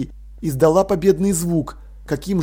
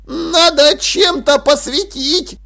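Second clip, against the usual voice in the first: high, loud, drawn-out protest; 9 semitones higher, 5 dB louder, slower than usual. A narrator's reading. Dil objects to going in dark, protesting loudly. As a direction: object in a raised, strained-up pitch. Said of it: "запротестовал Диль".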